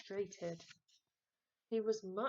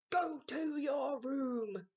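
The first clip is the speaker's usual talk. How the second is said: louder, slow, higher order; yell; 6 semitones higher, 7 dB louder, slower than usual. A narrator's reading. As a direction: send him off with a cross shout. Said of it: "yelled Mum".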